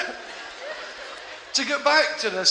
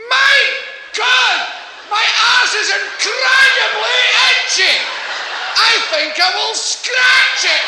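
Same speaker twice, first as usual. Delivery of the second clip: much higher, louder and slow, proudly announced; proud, happy voice; 6 dB louder, slower than usual. Shown as a demonstration. Comic advising the crowd, in a proud happy voice. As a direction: boast it to the whole street, slow and loud. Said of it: "say in a proud, happy voice".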